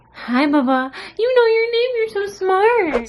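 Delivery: very high cooing voice to the cat. Real, not acted. Baby talk to the cat.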